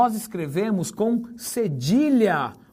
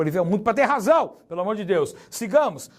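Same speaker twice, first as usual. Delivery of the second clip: normal talking voice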